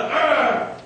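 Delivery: mock shove grunt. Acting out a rough shove.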